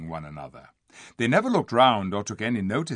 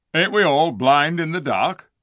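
high, loud, rustic retort; scoffing dwarf; 6 semitones higher, 9 dB louder than usual. A narrator's reading. Diggle retorting to the king, scoffing. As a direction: jeer back like a sour dwarf.

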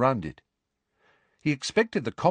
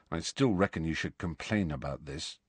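low and much softer; 3 semitones lower, 7 dB quieter than usual. A narrator's reading. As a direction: keep it down, a low murmur to Harry. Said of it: "said Ron in a low voice".

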